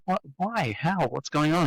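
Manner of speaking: high, bewildered what why how; replaying his own baffled reaction; retold disbelief. Telling how he reacted to the praise, with mock confusion. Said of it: "And I was going like".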